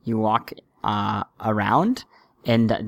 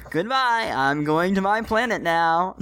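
alien voice; 7 semitones higher than usual. Acted. Continues the alien-like voice.

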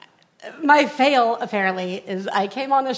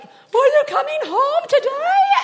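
put-on high, slow, hopeful child voice; little girl; 13 semitones higher, slower than usual. Mom imitating her daughter on FaceTime, high and slow. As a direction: ask it like a small girl missing mom. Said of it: "Ella's like".

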